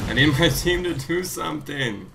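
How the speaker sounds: laughing through the words. Real, not acted. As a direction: breaks into a laugh mid-sentence. Laughs mid-line on repeat.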